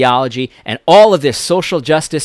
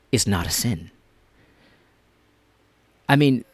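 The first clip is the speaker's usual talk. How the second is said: normal talking voice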